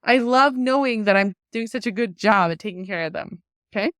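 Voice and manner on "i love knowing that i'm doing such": high, loud disbelieving question; exasperated mom. Retold or imitated. Mom scolding son who skipped brushing, high and loud. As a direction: throw it at him in mock outrage. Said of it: "I'm like".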